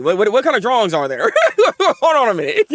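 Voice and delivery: laughing in disbelief. Real, not acted. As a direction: talk through real laughter.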